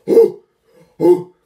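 sharp loud gasp of heat; gasping ooh. Real, not acted. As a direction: gasp at the burn. Reacting to ghost pepper heat.

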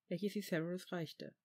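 plain reading voice

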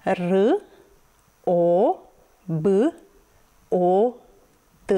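R, O, B, O, T as isolated sounds with pauses; each sound of R, O, B, O, T on its own. Shown as a demonstration. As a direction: sound out R, O, B, O, T, consonants with a short uh. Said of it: "literele în aceste silabe".

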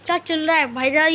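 ordinary talk, nothing marked

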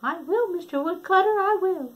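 put-on high, bright little girl promising. Red answers the woodcutter.

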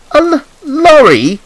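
high, loud, slow stammer of dismay; stammering Thomas. A narrator's reading. Thomas learns he goes by lorry, high dismayed stammer. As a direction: stumble on the L, then squeak the word out high. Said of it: "Thomas stammered".

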